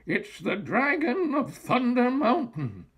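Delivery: put-on high, amazed old-witch voice; startled witch. A narrator's reading. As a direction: announce it with wide-eyed alarm. Witch recognizes the dragon.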